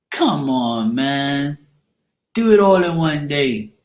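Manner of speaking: drawn-out teasing exasperation. Acted. Host ribbing readers who spread signs over days, teasing. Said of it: "I'm just teasing anyway".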